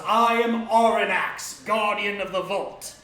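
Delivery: huge, slow, loud bellow; dragon bellowing. Acted. Dragon bellows his name to the frightened noble, slow and thunderous. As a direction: drag each word out like a roaring dragon. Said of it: "And the dragon bellows".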